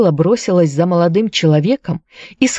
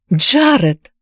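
higher-pitched startled cry; exclaiming; 6 semitones higher than usual. A narrator's reading. Anne cries out her beloved's name, exclaiming. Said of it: "вскричала Энн".

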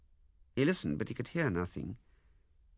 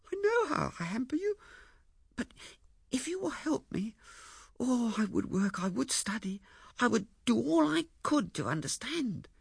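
high, earnest, halting plea; tearful pleading. Acted. Ethel begs Lewisham to help her learn, tearfully pleading.

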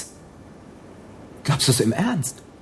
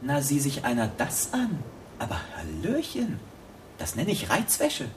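leering mockery; 9 dB quieter than usual. Gunman rummaging in her lingerie, soft leering sneer. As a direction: savour it, mocking and lewd.

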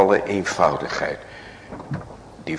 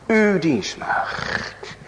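slow, drawn out; 11 semitones higher, much slower than usual. Acted. Repeated, drawn out.

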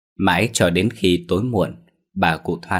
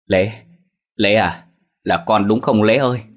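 louder pleading calls; anxious calling; 2 semitones higher, 4 dB louder than usual. A narrator's reading. Mother calling her son at the bamboo, anxious. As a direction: call his name, hoping he answers. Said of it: "miệng không ngừng gọi tên".

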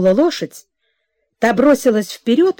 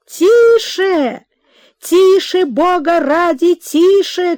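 high, loud calls to slow down; a mother shouting after her galloping daughter; frantic shout; 7 semitones higher, 4 dB louder, slower than usual. A narrator's reading. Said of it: "кричала мать вслед". (отчаянный громкий крик вслед)

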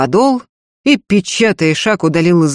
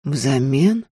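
flat, toneless echo; colourless voice; 4 semitones lower, 5 dB quieter than usual. A narrator's reading. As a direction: repeat it with no color at all. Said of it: "бесцветным голосом переспросил". (бесцветным голосом)

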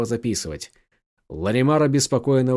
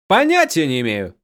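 cheerful; 4 semitones higher, 5 dB louder than usual. A narrator's reading. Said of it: "радостно ответил Гим".

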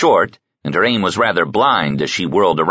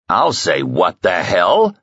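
slow, heavy thug voice leaning on hell; menacing thug; slower than usual. Acted. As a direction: drag out what the hell as a threat. Thug Hans repeats, menacing.

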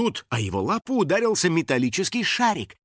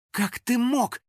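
furious cry; 10 semitones higher, slower than usual. A narrator's reading. Betrayed girl confronting her friend, furious. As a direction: cry out high, outraged. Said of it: "вне себя от ярости закричала".

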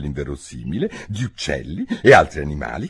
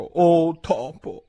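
slow, drawn-out "Oh topo", higher, ceremonious; drawn-out formal address; 9 semitones higher, much slower than usual. A narrator's reading. Alice closing her plea to the mouse, slow and solemn. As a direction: stretch it out like a solemn invocation. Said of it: "il modo più appropriato di rivolgersi a un topo".

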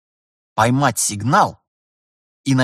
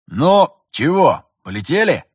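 commanding; 6 semitones higher, 5 dB louder than usual. A narrator's reading. Pilot's boss orders the crew to take off, commanding. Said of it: "Наконец скомандовал Терентьев".

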